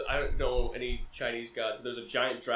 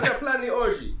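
higher quote broken by laughter; laughing; 8 semitones higher, 3 dB louder, faster than usual. Real, not acted. Host quoting Zeus's orgy line, laughing.

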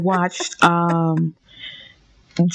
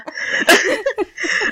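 bursting into high giggles; nervous high-pitched giggle; 14 semitones higher than usual. Real, not acted. Giggles before answering.

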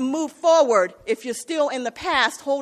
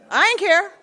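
fierce outburst; 6 semitones higher, 5 dB louder than usual. Retold or imitated. Recalls his old temper.

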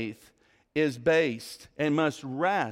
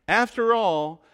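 normal talking voice

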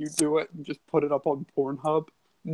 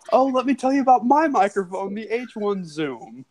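ad voice; 7 semitones higher than usual. Pretend. Parody microphone advert.

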